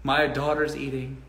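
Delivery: a lightly voiced proud dad, softly; pleased father. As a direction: murmur it like a satisfied father visiting. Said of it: "I can go".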